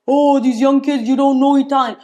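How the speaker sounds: lightly voiced nagging old relative; mocking the older generation. Mocking elders who complain the kids don't know Italian, scolding mock. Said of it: "the older generation says".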